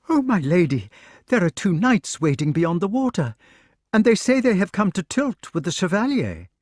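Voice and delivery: a hint of a flustered servant girl hurrying with news; anxious maid. Maid Gwyneth reports visiting knights.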